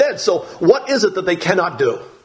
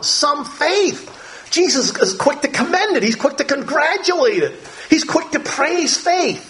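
emphatic praise; 10 semitones higher than usual. Real, not acted. Preacher stressing praise of faith.

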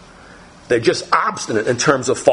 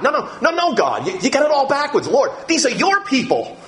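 high, urgent no-no-no protest; 7 semitones higher than usual. Acted. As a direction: correct God, insistent. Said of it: "Moses turns it around".

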